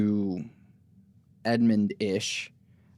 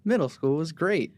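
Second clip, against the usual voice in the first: higher-pitched, lightly voiced middle-school fan; mock enthusiastic kid; 6 semitones higher than usual. Joking about who liked middle school, mock enthusiasm. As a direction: pretend to be the one kid who loved it. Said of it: "that one kid out there that was like".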